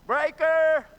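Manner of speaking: big high-pitched holler across camp; imitated yell. Camper when the power shuts down, acted full-voiced yell. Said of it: "And you'd yell out".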